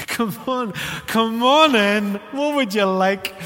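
slow, beaming come on in; delighted welcome. Retold or imitated. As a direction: throw the door open with glee. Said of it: "I'm like".

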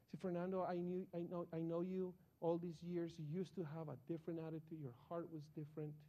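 usual speaking voice

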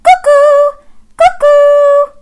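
very high, loud cuckoo; cuckoo call. Acted. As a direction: sing out like a clock bird. Cuckoo clock calling.

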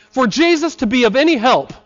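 ordinary talk

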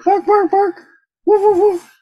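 high yappy barks; dog barks. Pretend. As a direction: bark and woof like a puppy. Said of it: "Do you speak dog?".

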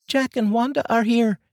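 put-on high, eager child's voice; boy excitedly announcing guests. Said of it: "noticeable excitement in his voice".

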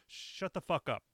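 ordinary talk, nothing marked

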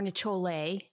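"Nichole" said as ni-cho-LAY; misread name. Shown as a demonstration. Guest listing what she gets called, mangled pronunciation. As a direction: say "Nichole" with CH and an added "lay" ending. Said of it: "sometimes called Nichole".

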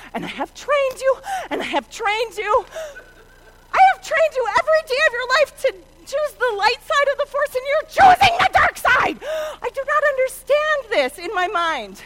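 high, loud lecture building to a shout; hammed-up rant at wayward kids; exasperated mom. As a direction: scold with rising outrage, then ease off slightly. Mother rants at kids, Star Wars style.

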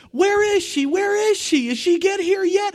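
put-on very high, loud child's voice; a small boy hunting for his mom; eager little boy. Drowned son searches for his mother, very high and eager.

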